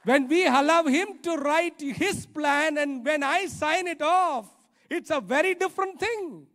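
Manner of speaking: loud, high preaching proclamation; fervent loud preaching; preaching at full force. Acted. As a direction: proclaim it with fervour. Preaching climax.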